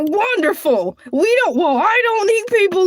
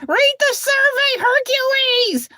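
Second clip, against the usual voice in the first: Grandma's reminder; 6 semitones higher than usual. Retold or imitated. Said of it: "Grandma told me I need to read the survey".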